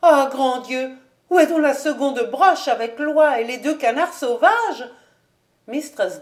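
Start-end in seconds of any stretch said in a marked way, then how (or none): 0.0-4.9 high, loud alarmed outcry; frantic, scandalised questioning; exclaiming. A narrator's reading. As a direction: cry out in dismay over the dinner. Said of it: "joignit ses exclamations à celles de sa mère".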